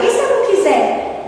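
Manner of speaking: high, scoffing whine; mocking question. Speaker contrasts the mocking question with the humble one, scoffing. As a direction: sneer it like a lazy refusal. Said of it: "A pergunta debochada".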